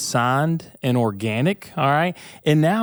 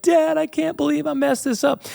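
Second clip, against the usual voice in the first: put-on much higher, small child's voice, upset; imitating young son; 12 semitones higher than usual. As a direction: play his eight-year-old son, close to tears. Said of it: "He's like".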